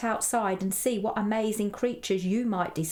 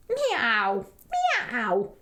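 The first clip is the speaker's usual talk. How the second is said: drawn-out imitated meows; cat meow; 3 dB louder than usual. A narrator's reading. Cat answers Edward from behind the tree, meowing. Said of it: "meowed the cat".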